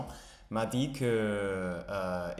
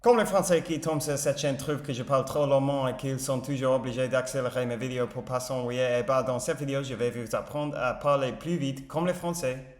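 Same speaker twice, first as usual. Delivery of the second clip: super fast shadowing; 3 semitones higher, 4 dB louder, faster than usual. Shown as a demonstration. Learner shadowing a very fast French video line, super fast. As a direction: race to keep up with the native speaker. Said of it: "c'est super méga hyper vite".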